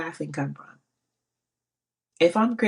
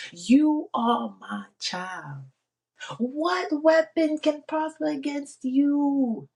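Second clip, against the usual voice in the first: slow tender God voice; 10 semitones higher, much slower than usual. Retold or imitated. God's voice, retold.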